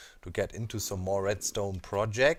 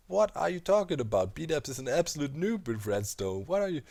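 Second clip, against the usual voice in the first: higher-pitched mock outrage; put-on incredulous protest; mock indignant commenter; 6 semitones higher than usual. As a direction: imitate a baffled online critic. Mimics indignant online commenters.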